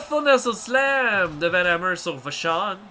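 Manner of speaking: lively wrestling play-by-play; wrestling commentator. Acted. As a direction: call the match live.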